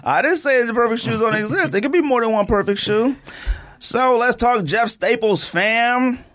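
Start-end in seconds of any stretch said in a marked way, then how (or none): none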